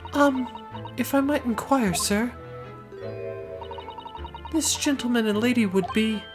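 high-pitched refined lady. Acted. Client speaks.

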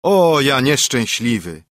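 lament. A narrator's reading. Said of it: "zawołał".

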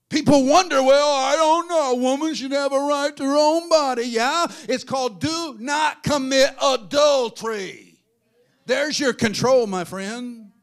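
high, very loud pulpit shout; loud, drawn-out indignant rebuke; full-force preaching. Real, not acted. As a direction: thunder it, stretching the key words.

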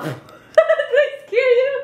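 high, giggling question; laughing. Real, not acted. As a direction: laugh through the words. Laughing after scaring him.

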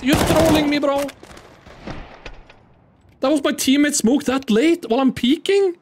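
shouting at a teammate whose smoke ruined his peek; angry outburst. Real, not acted. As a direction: yell at the teammate who blocked you.

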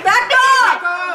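loud firm command; shouting. Shown as a demonstration. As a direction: yell it at the attacker. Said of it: "using our voice".

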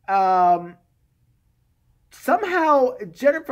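ordinary talk, nothing marked

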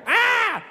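high mock baby wail; short imitated infant cry. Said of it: "he was crying".

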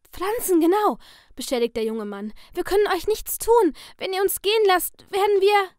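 high, loud panicked begging; young father pleading at gunpoint; desperate pleading. Acted. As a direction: beg for your life, voice climbing. Captive man pleads again.

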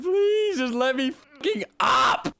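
high, slow, strained pleading shout; desperate plea. Real, not acted. Stuck gamer begs the game to let him climb, shouting. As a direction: beg the game at the top of your lungs.

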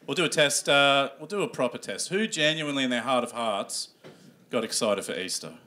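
ordinary talk, nothing marked

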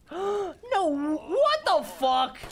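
gasp, then high, loud disbelief; slow, high wail of alarm after a gasp; shocked cry. Real, not acted. Player reacting to a shock in the game, shocked cry.

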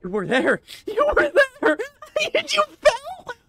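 very high, loud tearful shriek; anguished wail. Real, not acted. Gamer to himself after he fell, anguished high wail. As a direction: wail it at the top of your range, voice breaking up. Gamer has just fallen, wailing.

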